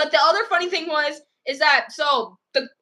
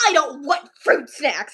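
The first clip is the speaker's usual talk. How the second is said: slow mock-angry child; tantrum refusal; 3 dB louder, slower than usual. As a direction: imitate a cross child refusing, drawing it out. Retells girl's tantrum.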